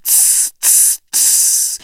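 hissing sea creature. A narrator's reading. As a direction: hiss like a newt. Newts hiss at the humans.

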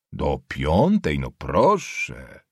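low, very slow, drawn-out repeat; mocking echo. Nobleman repeating the schoolboy's class, mockingly impressed. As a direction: stretch it out low in mock admiration.

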